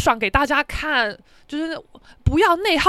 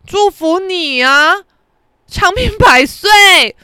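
slow, louder, higher sarcastic well-wishing; mock blessing shout; 4 semitones higher, 7 dB louder, much slower than usual. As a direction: drag out each word as a loud taunt. Mock-blessing the old man loudly. (拖长音大声嘲讽的祝福)

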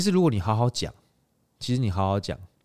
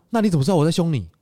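higher, louder rhetorical comeback; triumphant comeback; 10 semitones higher, 8 dB louder, faster than usual. Retold or imitated. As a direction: land the gotcha question. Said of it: "我说". (大声反问)

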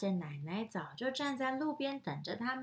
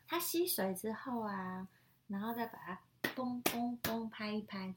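ordinary delivery

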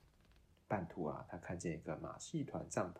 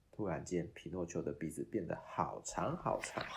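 usual speaking voice

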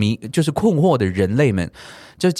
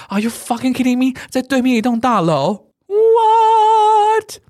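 much higher, slow, stretched-out shock; disbelieving outcry; 12 semitones higher, 4 dB louder, much slower than usual. Retold or imitated. Whole platform crowd reacting to the agent, stunned outcry. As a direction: let the What soar up and hang in disbelief.

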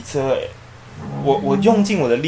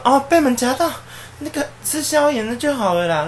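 high, loud chatty auntie voice; high-pitched auntie brushing it off; pharmacy auntie; 4 dB louder than usual. Retold or imitated.

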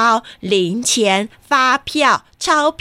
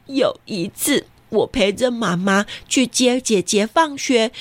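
usual speaking voice

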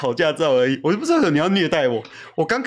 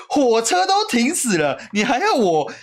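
higher, slow, exasperated disbelief; mock outrage at her company, pitched up; retold angry thought; 10 semitones higher, slower than usual. Recalling his silent fury on the typhoon trip, high exasperated voice. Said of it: "我就想说".

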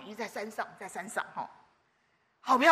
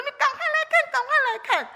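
much higher, louder, thrilled call to come look; excited call; 14 semitones higher, 7 dB louder, faster than usual. Retold or imitated. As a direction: shout for him to come see, amazed. Calling husband to see fireflies. (兴奋地高声叫人快来看)

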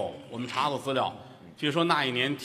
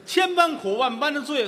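dramatic declaiming; 13 semitones higher, 4 dB louder than usual. Acted. Dramatic recitation of hardship.

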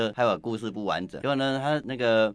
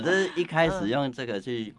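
ordinary delivery

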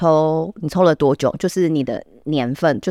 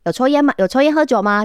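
higher, lightly voiced brisk nurse question; nurse asking; 7 semitones higher than usual. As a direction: mimic the nurse's routine question. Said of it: "然后护士就会说".

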